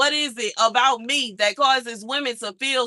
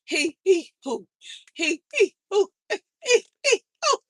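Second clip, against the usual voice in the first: high breathy he-he-hoo breathing, pitch climbing; calming breaths; 7 semitones higher than usual. Real, not acted. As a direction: puff it out to calm your nerves. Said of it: "I gotta calm my nerves".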